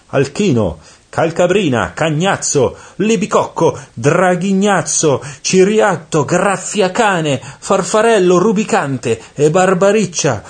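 very high, loud roll-call. A narrator's reading. Chief devil summons his demons one by one, calling out. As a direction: shout each name out, pitched right up.

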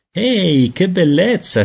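high-pitched, delighted cry; urlo entusiasta. A narrator's reading. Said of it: "si sentì urlare". (grido acuto di gioia)

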